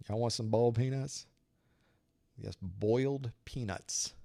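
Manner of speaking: put-on Southern accent. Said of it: "that's how they talk".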